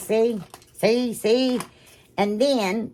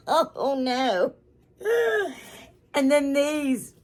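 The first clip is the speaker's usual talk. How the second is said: tearful; 7 semitones higher, much slower than usual. Real, not acted. Overcome by a gift.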